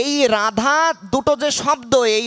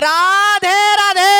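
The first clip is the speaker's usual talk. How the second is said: much higher, louder full-throated cry; loudest shout; 10 semitones higher, 5 dB louder, slower than usual. Shown as a demonstration. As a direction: give it everything, top of the voice. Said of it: "আরো জোরে বলতে হবে". (গলা ফাটিয়ে সর্বোচ্চ জোরে চিৎকার)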